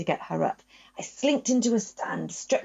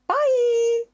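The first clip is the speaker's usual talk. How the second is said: put-on much higher, louder sing-song teen goodbye; Lottie's bye; 6 dB louder than usual. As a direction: mimic the girl's chirpy farewell. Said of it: "or as she would say".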